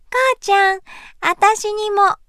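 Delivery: put-on high little girl pleading; a tiny girl chiming in. A narrator's reading. Younger child asks too.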